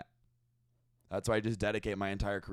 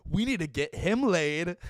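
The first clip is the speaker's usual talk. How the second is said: hyped frat guy; 7 semitones higher, 4 dB louder than usual. Retold or imitated. Mimicking excited college crowd.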